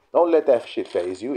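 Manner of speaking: usual speaking voice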